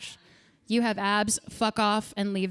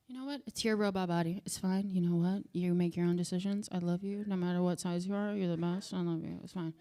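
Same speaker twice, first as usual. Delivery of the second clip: soft reassurance; 5 semitones lower, 8 dB quieter than usual. Retold or imitated. Owner soothing her Roomba, soft tender reassurance. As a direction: lower and much softer, tender.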